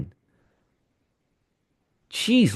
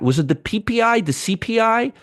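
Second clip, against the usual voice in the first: ordinary delivery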